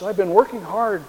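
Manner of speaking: a whiny, fearful complaint; whining. A narrator's reading. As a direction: complain like a frightened man. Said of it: "Elijah has the same response".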